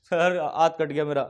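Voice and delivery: low, halting mock whimper; mock tearful. Injured boy answers the ticket checker, mock tearful. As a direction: imitate a boy tearfully owning up. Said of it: "रोने लग गया लड़का".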